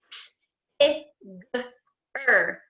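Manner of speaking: "T, I, g, er" said as separate sounds; sounded out letter by letter. Shown as a demonstration. Teacher sounding out Tigger for the class, one sound at a time. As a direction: pull Tigger apart into its sounds. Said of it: "let's do Tigger".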